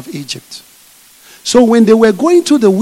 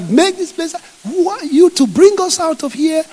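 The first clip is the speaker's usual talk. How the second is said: mocking complaint; 9 semitones higher than usual. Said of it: "they were like".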